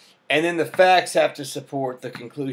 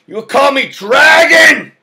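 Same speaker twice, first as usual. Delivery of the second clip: high, loud, slow shout; theatrical shout demanding a title; mock commanding shout; 10 semitones higher, 13 dB louder than usual. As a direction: boom it like a self-declared dragon. Demanding his new name.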